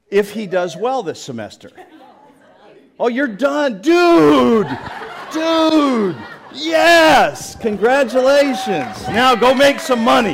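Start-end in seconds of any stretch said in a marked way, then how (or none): 3.0-10.3 high, loud whooping congratulations; jubilant shout. Real, not acted. Pastor cheers on a graduating student, jubilant shout. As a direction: cheer with long drawn-out "Dude!" and "Yes!".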